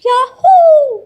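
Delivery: very high, very loud whoop; joyful cheer. Acted. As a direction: shout it with pure delight. Children cheer keeping apple.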